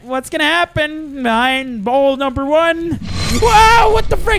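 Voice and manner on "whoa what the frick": very high, loud yelp of shock; startled shout. Real, not acted. Player surprised by bowl number one, startled shout. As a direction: explode in sudden alarm.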